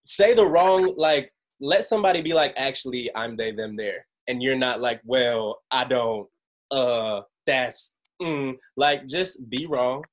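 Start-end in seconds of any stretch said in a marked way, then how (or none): none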